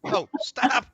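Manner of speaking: high loud gleeful cry; excited stab. Pretend. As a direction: shriek it with delight. Gleeful mock stabbing sounds.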